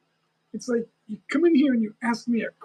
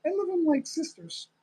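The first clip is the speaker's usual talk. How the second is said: ordinary delivery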